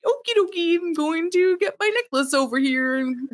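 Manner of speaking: put-on high, fast and bouncy kid voice; mock ditzy chirp; chirpy airhead. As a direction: play a cheerful clueless teen. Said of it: "Xander's like".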